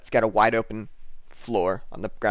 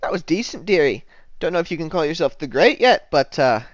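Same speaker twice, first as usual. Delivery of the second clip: higher, patronizing bartender; faint praise in a higher put-on voice; 6 semitones higher, 4 dB louder than usual. Bartender gives faint praise after the performance, higher role voice. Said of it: "the bartendress says".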